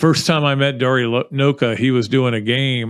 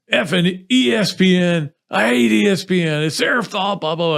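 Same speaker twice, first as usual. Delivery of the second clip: higher-pitched mock rant; retold yelling; 7 semitones higher than usual. Host re-enacts losing his mind in the press box, mock yelling. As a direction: re-enact a yelled tirade, not at full volume. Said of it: "walking back and forth in the press box yelling".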